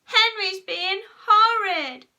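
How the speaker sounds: high, loud, drawn-out whine; wailing little tell-tale; shrieked tale-telling. A narrator's reading. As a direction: shriek the accusation to Mum. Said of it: "shrieked Peter".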